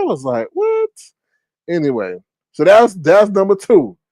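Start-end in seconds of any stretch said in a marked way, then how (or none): 0.5-0.9 high-pitched baffled what; comic squeak of confusion; mock disbelief. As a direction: act out being baffled, voice jumping up. Retold reaction to the kicking.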